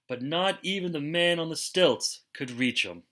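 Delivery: ordinary delivery, nothing marked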